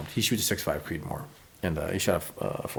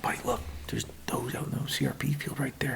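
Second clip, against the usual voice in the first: soft hunter's whisper; 5 semitones higher, 3 dB quieter than usual. Retold or imitated. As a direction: whisper so the deer won't hear. Whispering at deer.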